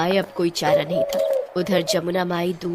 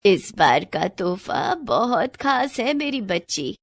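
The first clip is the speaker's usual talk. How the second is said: put-on slow, measured old woman's voice; affectionate grandmother; slower than usual. Grandmother tells the girl to come for her gift, affectionate. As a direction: unhurriedly build up a special surprise.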